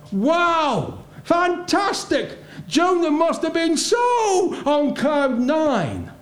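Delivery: high, ringing delight, rising to a shout; excited wonder. Real, not acted. Preacher marvels at Nineveh's repentance.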